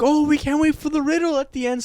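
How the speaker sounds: a touch of mock kid excitement; mock eager students. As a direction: gush like excited schoolkids. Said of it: "everyone would be like".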